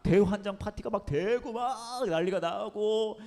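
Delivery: high, loud, slowed frantic telling; mock frenzy. Pastor reliving the chaos he caused, high and loud. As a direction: let the madness spill out. Describing wild party.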